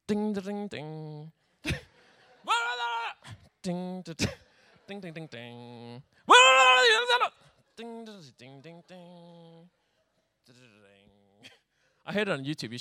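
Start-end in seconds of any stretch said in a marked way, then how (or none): 0.1-9.7 imitating the lyre. Shown as a demonstration. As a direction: hum the lyre tune, dying away. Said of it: "It kinda sounds like that".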